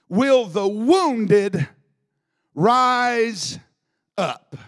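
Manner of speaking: slow, loud, each word set apart; emphatic statement. Shown as a demonstration. Preacher stating it to the church, slow and emphatic. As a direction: hammer the question like a statement. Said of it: "a very emphatic statement".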